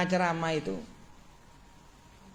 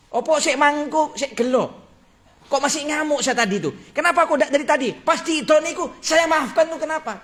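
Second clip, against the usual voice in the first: higher, louder preaching voice, pressing the point; fervent preaching; 7 semitones higher, 3 dB louder than usual. Acted. Preacher tells the congregation of the sheikh's regret, fervent preaching. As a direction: drive the lesson home with fervour.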